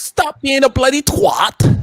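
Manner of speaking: high, slow mock British yell; mock British shout. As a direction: shout it like a cartoon Brit. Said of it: "She said".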